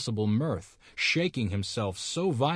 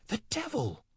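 startled scream; 13 semitones higher, 4 dB quieter than usual. A narrator's reading. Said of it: "screamed the pious old lady".

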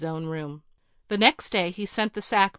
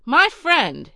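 proud; 7 semitones higher, 8 dB louder than usual. Acted. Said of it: "he began proudly".

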